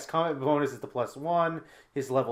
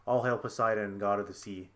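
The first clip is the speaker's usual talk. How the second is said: soft, low recital; understated, subdued repeat of a chant; thought in the head; 5 semitones lower, 6 dB quieter than usual. Retold or imitated. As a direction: murmur it like a passing thought. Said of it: "a thought coming to my head".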